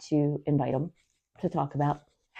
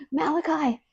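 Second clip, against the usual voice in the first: mock movie call; 13 semitones higher than usual. Quoting Children of the Corn.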